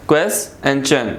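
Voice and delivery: QUEST and CHEN as separate beats; split into syllables. Shown as a demonstration. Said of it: "some have two syllables".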